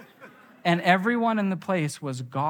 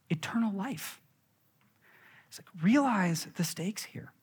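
whispered; 4 semitones higher, 6 dB quieter than usual. Real, not acted. Preacher stressing the stakes to the crowd, whispered. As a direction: whisper it, gravely. Solemn emphasis.

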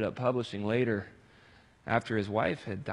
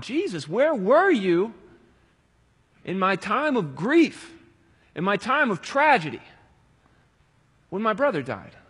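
anguished cry; 7 semitones higher, 8 dB louder than usual. Acted. Preacher crying out to Jesus as a grieving sister, anguished.

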